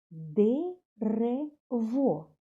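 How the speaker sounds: «Дерево» stretched very slowly, syllable by syllable, ДЕ-РЕ-ВО; чітко по складах, «Де-ре-во»; split into syllables. Shown as a demonstration. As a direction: clap out «Дерево» slowly for children. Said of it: "Скільки складів?".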